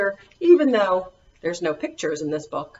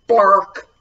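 mock bark; 8 dB louder than usual. Shown as a demonstration. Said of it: "even if the words say".

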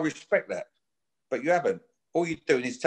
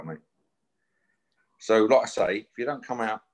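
ordinary talk

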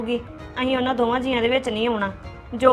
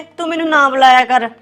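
quoted snub; 8 dB louder than usual. Retold or imitated. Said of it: "ਅੱਗੇ ਮੈਨੂੰ ਪਹਿਲਾਂ ਕੀ ਕਹਿੰਦੇ".

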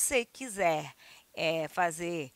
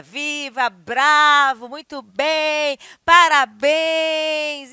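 loud slow bravo; applause cheers; 4 semitones higher, 10 dB louder, much slower than usual. Shown as a demonstration. Teacher demos applause, loud drawn-out bravos. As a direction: praise like applauding.

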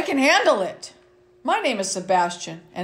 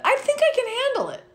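way up high, then falling; high hopeful refrain. Acted. Reading to kids, refrain said high and hopeful. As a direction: lift it high with hope. Child narrator's upbeat refrain.

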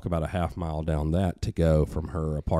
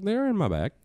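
high, innocent mock voice; innocent imitation. Daughter tells her father where her weapons are, innocent. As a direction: answer lightly, pitched up. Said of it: "She goes like".

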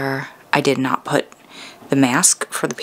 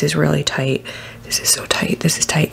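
whispering. Real, not acted. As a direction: whisper it softly. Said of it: "I'm being quiet".

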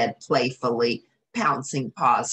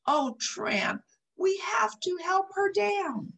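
pleading Lady. Acted.

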